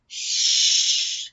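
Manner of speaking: loud unvoiced hush; a hard shh; shushing. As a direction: hush them sharply. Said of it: "said the third".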